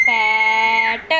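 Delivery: पैट drawn out very slowly and loud; stretched blend. Shown as a demonstration. Teacher sounding out पैट for children, loud and slow. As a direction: hold पैट long and strong. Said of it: "टी की साउंड होती है टे".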